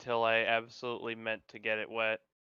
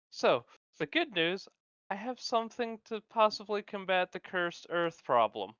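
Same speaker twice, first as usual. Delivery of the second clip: higher-pitched, putting good news forward; raised in-character pitch; Ash in character; 8 semitones higher than usual. Acted. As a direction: pitch it up, announcing a find to the group. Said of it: "Ash just kind of sighs deeply and goes".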